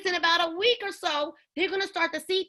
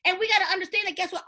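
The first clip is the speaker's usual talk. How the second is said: ordinary talk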